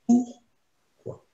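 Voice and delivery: "pourquoi" broken as "pour... quoi", with a gap; split in two words. Shown as a demonstration. Offering students the other translation, deliberately split. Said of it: "on le traduit pourquoi? Ou bien".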